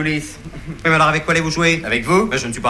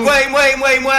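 high, louder comic protest; indignant retort; 9 semitones higher, 5 dB louder than usual. Acted. Partner answering the charge, indignant high retort. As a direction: protest high, insisting on each repeat. Quarrel refrain, indignant reply.

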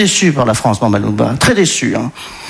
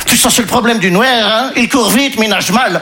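put-on Belgian accent; 6 semitones higher than usual. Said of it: "Il me confiait".

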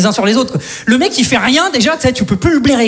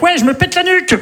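imagined guy yelping; 7 semitones higher than usual. Acted. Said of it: "T'imagines le mec".

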